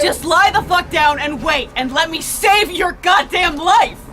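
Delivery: slow, loud angry order; furious scolding; angry shout. Acted. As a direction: yell it back in fury. Driver angrily refuses.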